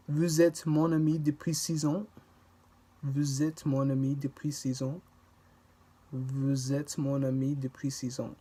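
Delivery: linking the S of vous into êtes; careful classroom model, vouz-êtes; démonstration de la liaison; liaison demo. Shown as a demonstration. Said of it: "the S from vous links".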